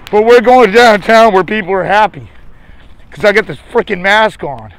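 normal talking voice

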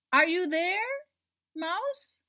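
high, loud searching call. Acted. Cat calling for hidden Mouse, high and loud.

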